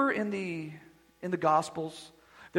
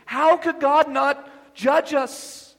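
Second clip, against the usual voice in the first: slow, louder and higher, each word hammered; thundered question; 6 semitones higher, 7 dB louder, much slower than usual. Acted. Preacher thundering judgment at the congregation, slow and loud.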